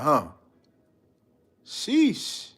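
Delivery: ordinary delivery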